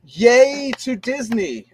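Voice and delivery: slow, high, loud drawn-out cheer; mock cheer. Viewer praising Disney for something different, mock cheer. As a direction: stretch out a hammed-up hooray.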